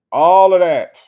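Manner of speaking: loud emphatic agreement. Real, not acted.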